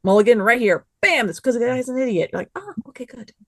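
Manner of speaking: ordinary talk, nothing marked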